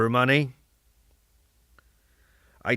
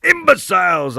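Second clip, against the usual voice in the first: higher, louder outburst; indignant shout; 6 semitones higher, 7 dB louder than usual. Acted. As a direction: shout it out at the crowd. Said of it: "I shouted".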